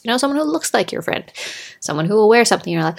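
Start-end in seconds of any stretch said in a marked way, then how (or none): none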